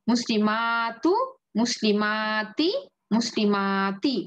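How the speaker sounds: drawn out syllable by syllable, মুসলিমাতি with each vowel held; teacher slowing the drill so students can follow; slow recitation. Shown as a demonstration. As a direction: spell the Arabic out slowly for learners. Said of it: "ফেমিনিনেও আমরা কি বলতেছি দেখেন".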